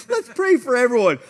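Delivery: high, loud mock-pious voice; self-mockery. Man mocking how he started with heart, self-mockery. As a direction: send up your own good intentions, high and loud. Mocks his own earlier sweet intention.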